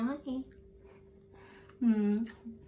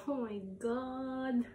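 higher, slow voice breaking into tears; crying; 4 semitones higher, much slower than usual. Real, not acted. Vlogger missing her father, voice breaking into tears. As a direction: let the tears break through, slowly. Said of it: "Naiiyak ako".

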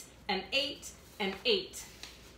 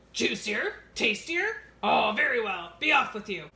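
louder troll voice; 5 dB louder than usual. Acted. Troll letting the little goat go, louder. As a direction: bark it out grudgingly as the troll.

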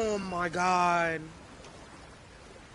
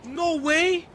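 high, excited disbelief; 8 semitones higher than usual. Real, not acted. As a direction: react like you found treasure. Excited discovery in game.

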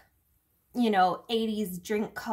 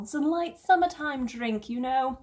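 attempted English accent; 3 semitones higher than usual. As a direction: try an English accent and botch it. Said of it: "I can't do it. It's awful".

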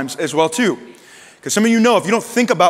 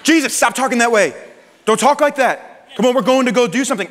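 high-pitched mock reprimand; acted telling-off, pitched up; Peter; 6 semitones higher than usual. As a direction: scold in a raised pitch, urgently. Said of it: "Can you imagine reprimanding Jesus?".

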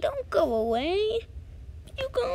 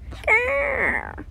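sea creature growl; 9 semitones higher than usual. Acted. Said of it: "Your languages even sound dis-, di-, different".

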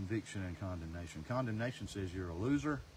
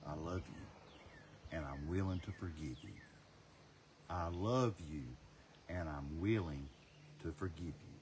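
tender voice of God; 4 semitones lower, 5 dB quieter, slower than usual. Acted. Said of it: "is God saying".

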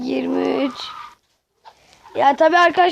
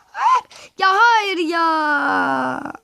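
dismayed cry; much slower than usual. Real, not acted. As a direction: cry out as the run is lost. Loses the game.

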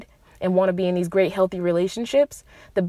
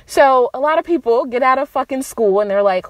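ordinary delivery

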